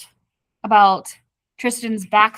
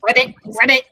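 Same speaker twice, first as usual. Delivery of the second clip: frog croak; 4 dB louder than usual. Retold or imitated. Frog interrupts their kiss.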